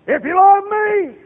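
high, loud, slow plea on love; Jesus pleading. Retold or imitated. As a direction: cry out as Jesus. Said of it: "Jesus said".

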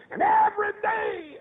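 high, slow preacher's cry; impassioned preaching. Real, not acted. Preacher recalling his childhood to the congregation, high wailing cry.

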